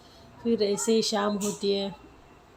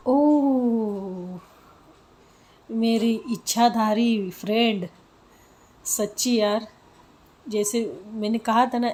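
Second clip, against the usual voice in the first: teasing gush; 2 semitones higher, 8 dB louder, slower than usual. Acted. Samiksha teasing her friend.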